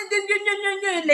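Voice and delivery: high mocking blah-blah chatter; mocking gna-gna chatter. Mocking people who chatter. (bavardage moqueur gna-gna, plus aigu)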